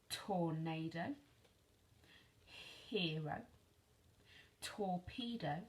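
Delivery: "Tornado" said slowly for writing down; slow dictation. Shown as a demonstration. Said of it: "change these words".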